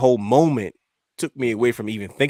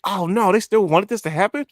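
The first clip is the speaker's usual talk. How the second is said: shocked disbelief; 11 semitones higher, 4 dB louder than usual. Retold or imitated. Shocked at fans' reaction.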